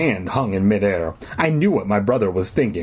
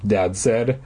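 ordinary delivery, nothing marked

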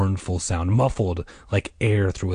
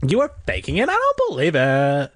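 high-pitched acted outrage; outraged cry; 6 semitones higher than usual. A narrator's reading. Diane accusing the prankster, crying out. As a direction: wail it in disbelief. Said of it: "Diane cried".

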